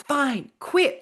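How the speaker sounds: husband finally caving in anger; exasperated husband. Retold or imitated. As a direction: throw it out in defeat. Husband gives in during fight.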